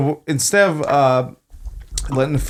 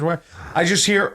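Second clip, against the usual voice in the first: normal talking voice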